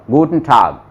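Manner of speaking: गुटन ठाग with a throaty final g; German pronunciation. Shown as a demonstration. Teacher shows गुटन ठाग ending sound. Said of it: "sound बोलते time ऐसे लगता है".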